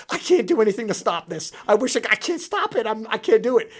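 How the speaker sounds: loud, helpless mock despair; retold helpless anguish. A coach unable to stop the lift, loud mock anguish. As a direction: cry it out like you're watching a train wreck. Train wreck he can't stop.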